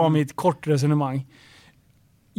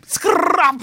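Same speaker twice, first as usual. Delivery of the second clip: high squeaky sound effect; 10 semitones higher than usual. Acted. Friend blurts a sound effect.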